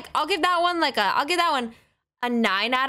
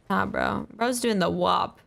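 normal talking voice

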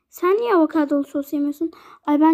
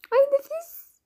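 much higher, thrilled little cry; delighted; 10 semitones higher than usual. Acted. Friend tasting the waffle, delighted. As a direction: swoon over the taste.

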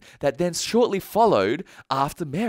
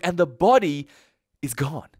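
normal talking voice

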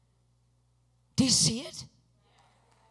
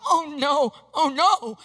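loud, alarmed wail, re-enacted; mock panic; 3 semitones higher, 6 dB louder, slower than usual. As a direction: cry out in played-up dismay. Retold panic as picture drops.

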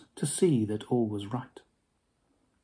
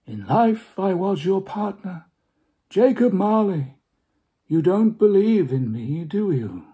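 higher, hollow spectral partner; Marley's ghost; 6 semitones higher, 4 dB louder than usual. Acted. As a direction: speak as the dead partner.

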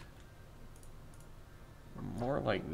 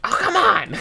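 high, loud exasperated shout; frustrated shout; 13 semitones higher, 11 dB louder than usual. Real, not acted. Player yelling at his failing coaster, exasperated. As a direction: yell at the screen. Ride loses excitement again.

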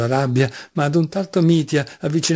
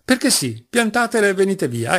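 higher-pitched, impatient order; insistent protest; 7 semitones higher than usual. Acted. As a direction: cut the game short, insisting. Kalganov orders them to stop. (protesta insistente, tono più acuto)

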